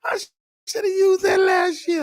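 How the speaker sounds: high, cracking laugh-shout. Real, not acted. Laughing shout at fan joke.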